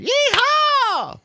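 very high-pitched, hammed-up yeehaw; whooping cowboy cheer. Game master as the young soldier amazed by the shooting, whooping cheer. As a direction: whoop it like an excited kid. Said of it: "slaps his hands together and goes like".

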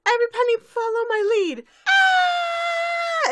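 very high, loud fast yell; Pinkie Pie shout. Retold or imitated. Pinkie Pie leading the fleeing crowd, shouting. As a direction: yell and scream. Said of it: "Pinkie Pie".

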